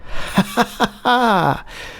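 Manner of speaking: rapid run of chuckles; laugh. Real, not acted. Player amused by his own failed accent, laughing. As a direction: laugh it off in a quick burst.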